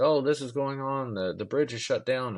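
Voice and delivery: lightly mock a television traffic alert; TV news report. TV reporting the bridge closed, lightly imitated newscaster. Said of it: "what the T V was saying".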